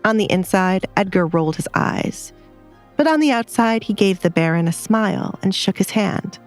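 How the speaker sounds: ordinary delivery, nothing marked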